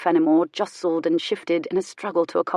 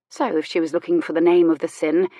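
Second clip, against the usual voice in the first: plain reading voice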